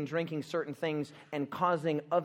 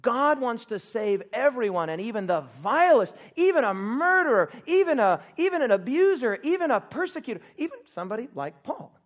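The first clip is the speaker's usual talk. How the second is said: louder, higher, building preacher's cry; rising preaching; 7 semitones higher, 6 dB louder than usual. Acted. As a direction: build each even toward the climax.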